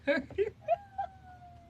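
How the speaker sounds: high, breathless giggle; nervous laugh. Real, not acted. Parent giggling despite the crying kids. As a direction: laugh through the stress. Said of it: "It's not funny".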